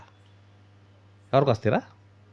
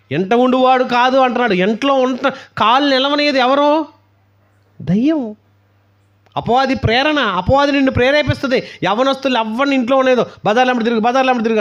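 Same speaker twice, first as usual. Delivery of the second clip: preaching at full force; 9 semitones higher, 4 dB louder than usual. Acted. Preacher warning the youth about the devil, forceful sermon. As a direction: thunder it at the congregation.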